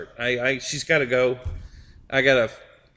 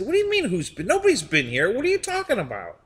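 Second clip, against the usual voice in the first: higher, incredulous retold reply; mock disbelief; 9 semitones higher than usual. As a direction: act baffled by her silly question.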